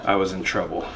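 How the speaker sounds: low, quiet hard-boiled line; noir detective. Acted. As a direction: deliver it like a tired gumshoe.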